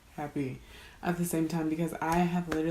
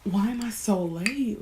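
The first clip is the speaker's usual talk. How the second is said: usual speaking voice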